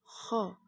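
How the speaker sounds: "Kho" serak bergesek di tenggorokan; seperti mendengkur. Shown as a demonstration. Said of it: "seperti suara orang men-, sedang mendengkur". (bunyi dengkur pada "Kho")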